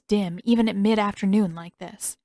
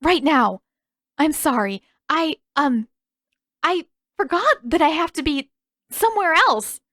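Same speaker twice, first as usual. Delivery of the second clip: flustered panic; 7 semitones higher, 4 dB louder than usual. A narrator's reading. Flustered hurried exit.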